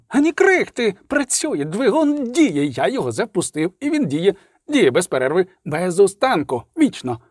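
high, breathless cries of triumph; gush, almost hopping with joy; ecstatic professor. Acted. Oppenhauser urging colleagues to the workshop, ecstatic. Professor raving about his engine.

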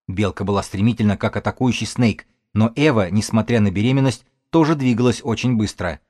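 usual speaking voice

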